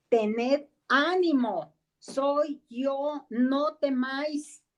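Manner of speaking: calm the frightened men, drawn out and kind; reassuring Jesus. Acted. Jesus calms his disciples on the sea, slow reassuring voice. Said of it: "Jesús les habló diciendo".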